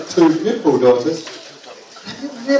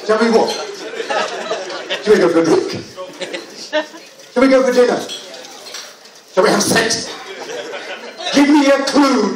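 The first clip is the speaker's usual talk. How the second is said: mock exasperation; 5 semitones higher than usual. Exasperated reply to vague question.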